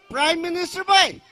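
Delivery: ordinary talk, nothing marked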